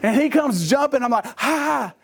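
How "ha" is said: scare the dog off with a yelp; sudden shout. Retold or imitated. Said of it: "I just screamed at the dog".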